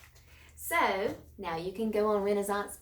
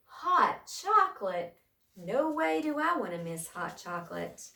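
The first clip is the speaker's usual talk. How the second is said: excited; 5 semitones higher, 4 dB louder than usual. Real, not acted. Teacher excited aside.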